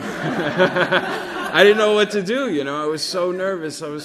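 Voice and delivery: real laughter spilling through the words, high and slow; can't get the words out for laughing. Real, not acted. Laughing at the memory of freezing up as a kid.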